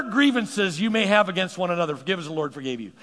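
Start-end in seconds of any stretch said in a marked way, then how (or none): none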